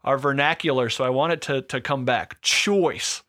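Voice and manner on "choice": lightly bleated East Coast "Choice"; a hint of East Coast accent. Shown as a demonstration. Co-host's demo of "Choice" with a hint of Jersey accent. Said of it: "you have to say it like that".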